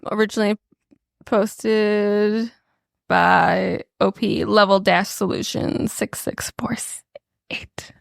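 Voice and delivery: hushed, breathy whisper. Pretend. Co-host reads the post's credits to listeners, whispering. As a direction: read it out in a whisper. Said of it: "Why are we whispering?".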